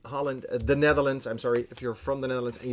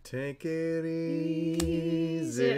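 drawn out; 3 semitones higher, much slower than usual. Real, not acted. Naming the card as game fails.

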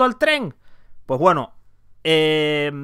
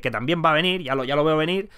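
ordinary delivery

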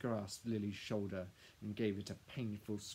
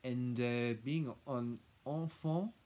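condescending interjection; 3 semitones higher, 6 dB louder, slower than usual. A narrator's reading. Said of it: "Madame cut in".

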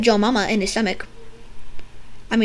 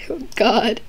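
usual speaking voice

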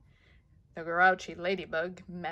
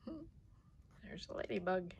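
plain reading voice

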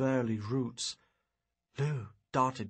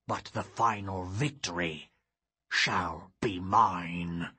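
menacing vow; 5 semitones lower, slower than usual. Acted. Sorcerer swearing final victory before vanishing, slow low menacing vow. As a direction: draw out the last words as a dark promise.

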